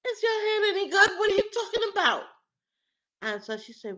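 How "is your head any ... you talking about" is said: loud, high-pitched incredulous retort; acted shocked outburst, very loud. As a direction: fire back in shrill-high disbelief. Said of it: "And I'm shocked".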